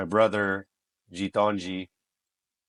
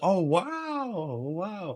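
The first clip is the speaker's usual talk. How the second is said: high, drawn-out impressed wow; mock awe; 10 semitones higher, much slower than usual. Casting director admiring brother's lion roar, high mock awe. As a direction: gush in hammed-up admiration.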